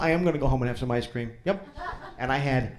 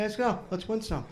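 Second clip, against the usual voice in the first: ordinary delivery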